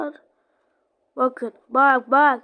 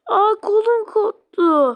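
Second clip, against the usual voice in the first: slow, whimpering over a lost arm; hammed-up sob at the pain; a ragdoll wailing that its arm came off; mock crying; 5 semitones higher, slower than usual. Character's arm torn off.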